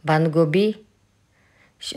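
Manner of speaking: usual speaking voice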